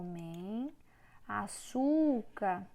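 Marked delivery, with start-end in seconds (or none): 1.3-2.7 Açúcar drawn out slowly, syllable by syllable; slow teacher spelling out Açúcar; teacher slowly sounding out a word for pupils; stressed syllable demo. Shown as a demonstration. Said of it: "é o su".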